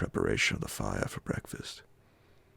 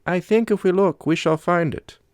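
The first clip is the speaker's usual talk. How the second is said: much higher, louder foreign companion voice; the Swede; 14 dB louder than usual. A narrator's reading. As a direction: give the Swede a high, loud, strained voice.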